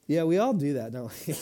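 ordinary talk

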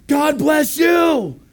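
high, loud, drawn-out shout; called-out blessing. Retold or imitated. Preacher blessing a street he thinks cursed, long shout. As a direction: holler it slowly, stretching every word.

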